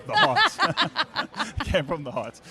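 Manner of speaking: amused, laughing between the words. Real, not acted. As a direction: say it through a laugh. Jokes about speaking from heart.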